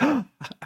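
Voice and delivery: mock frightened gasp; short, high intake of alarm, acted out; scared gasp. Acting Moses caught out over the killing, scared gasp. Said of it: "he's, like, scared".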